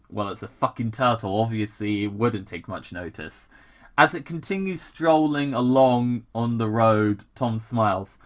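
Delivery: ordinary delivery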